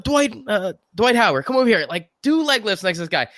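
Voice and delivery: high-pitched mock summons; retold call-over, pitched well up; calling someone over. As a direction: imitate an amazed player beckoning a teammate. Teammates calling Dwight Howard over.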